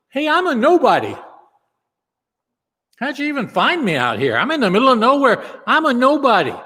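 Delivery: high, pleading whine of a reluctant man; protesting Moses. Acted. Moses objecting to God's call, high pleading whine. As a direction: beg to be let off the hook.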